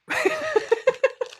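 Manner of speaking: high-pitched imitated giggle of her kid; mother imitating her daughter's sheepish giggle, high-pitched. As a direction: mimic the daughter's high little laugh. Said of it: "she was just like".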